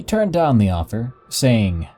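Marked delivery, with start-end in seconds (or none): none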